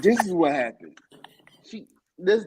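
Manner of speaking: hushed whispered aside. Real, not acted. Friend whispering a knowing aside to the host. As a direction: lean in and whisper it. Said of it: "whispered aside to co-host".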